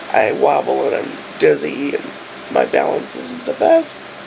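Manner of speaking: rough voice like broken gravel; hoarse, as if gargling with gravel; gravelly sick voice. Real, not acted. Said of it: "my voice sounds like broken gravel".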